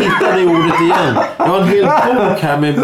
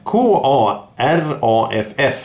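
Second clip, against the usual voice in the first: spelling out; 2 semitones lower, much slower than usual. Shown as a demonstration. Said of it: "kan du stava?".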